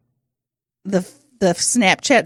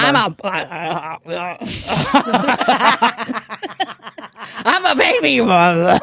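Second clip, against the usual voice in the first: laughing through a put-on old voice; giggling old man impression; 3 semitones lower than usual. Boomer line through laughter.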